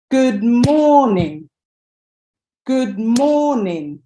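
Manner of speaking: slow "Good morning", long swelling "mor", falling end; stress on MOR. Shown as a demonstration. Teacher clapping the stress for students, slow "Good morning" stressed on MOR. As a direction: beat out "Good morning" slowly, leaning hard on "mor". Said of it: "I'm putting the stress".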